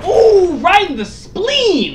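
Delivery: very high, loud, drawn-out wail; mock-pained outcry. Co-host reacts to gamer being hit in game, hammed-up outcry. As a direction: cry out in hammed-up sympathy pain.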